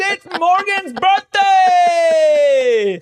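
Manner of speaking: high, loud mock announcer shout; hammed-up announcement. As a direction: belt it out like a waiter hyping a birthday. Imitating restaurant birthday announcement.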